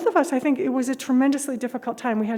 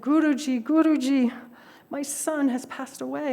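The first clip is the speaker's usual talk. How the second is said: heartbroken plea; 4 semitones higher than usual. Retold or imitated. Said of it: "she's heartbroken".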